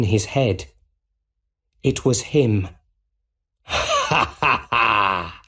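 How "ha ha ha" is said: slow, deliberate evil laugh; drawn-out mocking villain cackle; villain's cackle. A narrator's reading. Goblin laughs inside Osborn's head, evil laugh. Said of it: "laughed the Green Goblin".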